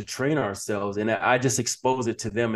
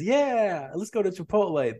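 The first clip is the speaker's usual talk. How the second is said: mock eager agreement; 8 semitones higher than usual. Guest plays everybody saying yes to dinner, mock eager agreement. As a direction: imitate a group happily going along.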